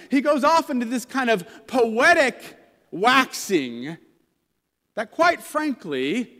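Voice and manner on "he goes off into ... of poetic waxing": ordinary delivery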